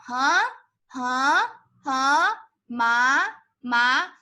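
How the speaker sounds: HUH and MA each rising like a question; second tone. Shown as a demonstration. Teacher drills students on second tone, each syllable rising. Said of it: "remember the second tone".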